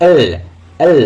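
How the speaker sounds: এল with a drawn-out final l; correct pronunciation. Shown as a demonstration. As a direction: linger on the l of এল. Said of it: "দেখুন জিভটা". (এল-এর সঠিক উচ্চারণ, ল টেনে)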